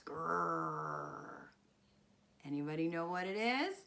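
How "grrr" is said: low, soft rolling growl; drawn-out quiet animal growl; bear growl. Acted. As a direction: growl softly like a big bear. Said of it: "and it growls".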